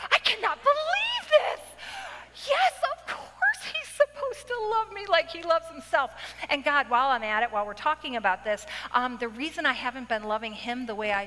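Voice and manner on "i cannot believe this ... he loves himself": much higher, indignant squeal of disbelief; mock indignation. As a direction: play the outraged wife with big theatrical disbelief. Acting out her indignant prayer.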